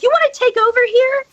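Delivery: high-pitched, quick retold demand; imitated impatient query; exasperated prodding. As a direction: prod a dawdler with a high, hurried question. Prodding slow EMS worker, acted out.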